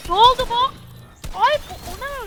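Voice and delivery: loud, high startled cry; shocked outburst. Real, not acted. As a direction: react in sudden shock. Sudden death in game.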